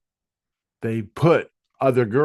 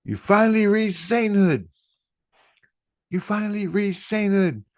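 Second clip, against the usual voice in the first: high-pitched, excited retold proclamation; excited announcement; 10 semitones higher than usual. They announce that he reached sainthood, excited and high. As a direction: imitate voices proclaiming long-awaited news.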